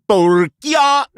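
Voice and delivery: high, stretched, hammed-up Latin "Porcia"; exaggerated mock Latin. Comic trying to outdo rival's answer, hammed-up high mock Latin. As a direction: belt out an overblown Latin POR-KEE-A.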